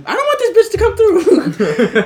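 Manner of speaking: loud, high, drawn-out wail; comic dread. Retold or imitated. Worker hiding in the back from customer, loud high comic wail. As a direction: wail it through laughter, pitch way up.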